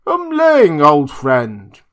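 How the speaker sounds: very high, loud call into the night. A narrator's reading. Drunk doctor calling for Umling outside, very high and loud. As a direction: call his servant from afar. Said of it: "he cried".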